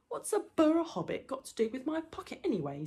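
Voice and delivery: high, affronted troll question; indignant troll. Acted. Troll asking about his picked pocket, indignant. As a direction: demand it as a wronged troll.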